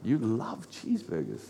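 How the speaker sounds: low, very soft puzzled question; mild mock disbelief. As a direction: question it quietly, as if baffled. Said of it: "And I'm like".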